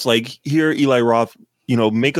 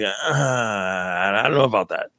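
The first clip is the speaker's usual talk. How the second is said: doubtful aside; 3 semitones lower, 3 dB quieter, slower than usual. Retold or imitated. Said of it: "I'm like".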